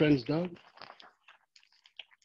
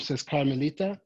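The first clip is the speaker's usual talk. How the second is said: plain reading voice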